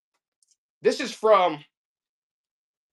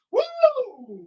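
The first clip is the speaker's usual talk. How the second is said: high whoop. Real, not acted. Whoops on stream.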